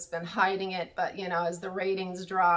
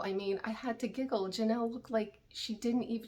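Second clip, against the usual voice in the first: usual speaking voice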